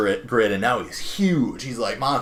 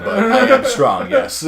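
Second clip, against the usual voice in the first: hammed-up heroic hero voice, pitched much higher and louder; mock heroic voice; 12 semitones higher, 9 dB louder than usual. Clark answering the swat leader, in a hammed-up heroic voice. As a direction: puff it up like a proud superhero.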